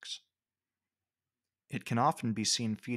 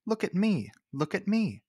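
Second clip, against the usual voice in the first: high-pitched, louder show-off cry, falling on "me"; attention-seeking mock scream; 9 semitones higher, 4 dB louder than usual. Pompous magpie strutting across the ground, mock-screaming for attention. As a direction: play a pompous bird demanding to be seen. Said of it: "just screams".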